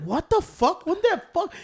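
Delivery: mock bewildered, trying to catch up; lost and questioning, as remembered; drunken bafflement. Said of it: "I was trying to grasp myself".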